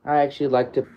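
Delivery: ordinary delivery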